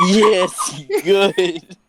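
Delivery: gloating villain, laughing; supervillain voice. Pretend. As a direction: savour it like an evil genius. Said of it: "You sounded like a supervillain".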